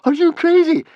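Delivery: very high, loud outburst; shrieked objection; interrupting. A narrator's reading. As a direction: cut in with an incredulous yell. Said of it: "interrupted Fishlegs".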